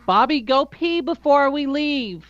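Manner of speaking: high, slow motherly nag; mock mom calling her son to the bathroom; mom's voice. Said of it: "my mom used to say".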